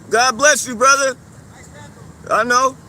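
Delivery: loud, mocking farewells; loud call-out. Real, not acted. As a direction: keep shouting taunts after him. Taunting him as he leaves.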